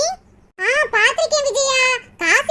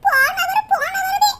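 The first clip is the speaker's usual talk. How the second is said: tearful lament rising higher as it builds; crying; 4 semitones higher than usual. Acted. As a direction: weep out the story of a husband who never returned. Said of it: "நினைச்சு தாயா அழுகுறேன்".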